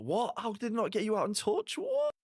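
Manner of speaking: high squeaky outraged "what?"; real stunned outrage; shocked disbelief. Real, not acted. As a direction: pitch shooting right up in disbelief at the call. Shock at a missed touch call.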